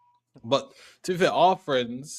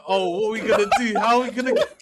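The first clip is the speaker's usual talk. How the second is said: laughing; 8 semitones higher, 7 dB louder, faster than usual. Retold or imitated. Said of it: "he's like".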